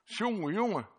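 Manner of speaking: high, loud taunting tut-tut; mocking accuser. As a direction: sneer it like the tempter keeping score. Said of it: "de boze met de gedachte".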